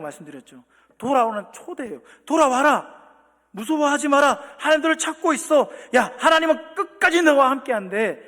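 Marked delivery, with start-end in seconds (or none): 2.3-8.2 urgent call. Acted. Calling sinners back.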